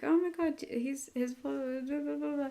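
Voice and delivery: high, breathless fangirl gushing; excited gush. Retold or imitated. Jazz gushing over Rookie, high and excited. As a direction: trail into blah blah like she can't cope.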